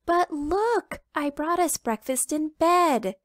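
high, bright-eyed chick voice; eager little chicken. Acted. Little chicken showing Papa breakfast in bed, high and eager. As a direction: chirp it up like an excited chick.

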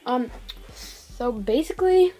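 usual speaking voice

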